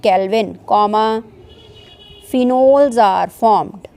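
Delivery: very slow, phrase by phrase, with long pauses; slow dictation. Shown as a demonstration. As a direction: dictate each chunk so students can write it down. Teacher dictates second rule.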